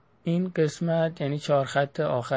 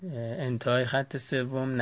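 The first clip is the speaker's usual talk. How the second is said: ordinary talk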